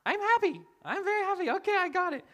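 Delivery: put-on very high, loud child squeal; squeaky, pleading toddler voice; panicked little girl. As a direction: imitate a kid frantically backtracking. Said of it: "And she's like".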